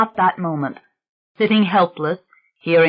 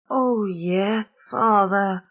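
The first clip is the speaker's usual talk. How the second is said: put-on soft, high, tired child's reply with a sob; worn out from not being believed; weary little girl; 3 semitones higher, 3 dB quieter, much slower than usual. Girl answers the priest, high, weary and teary. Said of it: "So weary".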